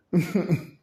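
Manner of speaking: a sudden real laugh; loud laugh. Real, not acted. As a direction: burst of high, loud laughter. Bursts out laughing.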